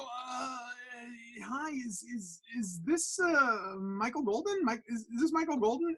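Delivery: high, soft, halting phone voice; nervous stammer. Retold or imitated. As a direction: replay timid, tongue-tied hesitation. Acts his nervous phone opening.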